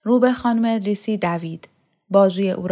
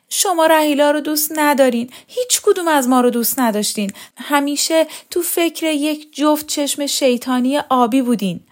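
high, raised-voice wailing accusation; loud tearful outburst; 7 semitones higher, 5 dB louder than usual. A narrator's reading. Daughter kneeling, accusing mother, loud tearful outburst. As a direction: accuse through acted tears. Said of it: "با صدای بلند گریه سر داد".

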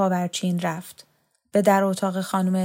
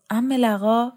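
plain reading voice

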